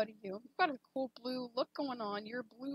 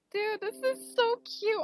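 excited gush; 8 semitones higher, 6 dB louder than usual. Real, not acted. Reacts to cute fan art.

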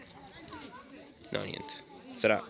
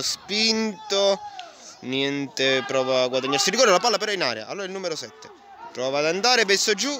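excited play-by-play; 9 semitones higher, 5 dB louder than usual. Real, not acted. Penalty-claim action call.

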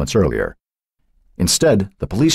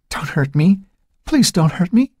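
high, frightened whimper; small, pitched-up pleading; 14 semitones higher than usual. A narrator's reading. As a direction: plead faintly in terror. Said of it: "barely audible, whimpering".

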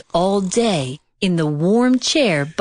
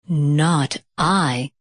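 low, lazy cat refusal; a lazy, low cat shrugging it off; 5 semitones lower than usual. A narrator's reading. Cat refusing the hen from the couch, low and lazy. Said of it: "said the cat".

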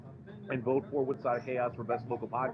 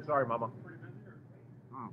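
ordinary talk, nothing marked

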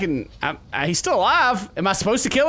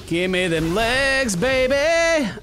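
slow, drawn-out croon rising higher; hammed-up craving; 6 semitones higher, slower than usual. Player going after the Titan's legs, hammed-up craving. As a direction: stretch every word in mock lust.

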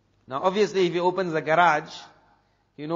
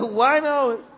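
higher and louder, disbelieving; astonished echo, pitched up; surprised question; 7 semitones higher, 4 dB louder than usual. Retold or imitated. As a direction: shoot it back in disbelief. Amir surprised at the answer.

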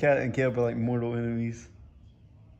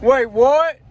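surprised; 12 semitones higher, 13 dB louder than usual. Real, not acted. Sudden shock in the drive-through.

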